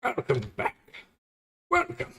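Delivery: very high-pitched playful welcome voice; put-on greeting voice. Streamer welcoming a viewer back, in the obligatory high voice. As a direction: greet them in a silly squeaky-high put-on voice. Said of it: "I gotta do the voice".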